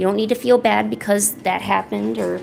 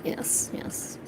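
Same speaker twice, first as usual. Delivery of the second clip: whispered; 15 dB quieter than usual. Real, not acted. Quiet aside.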